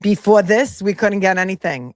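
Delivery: mock Brooklyn accent. Vlogger imitates the clerk, lightly Brooklyn accent. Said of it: "that's a Fall River accent?".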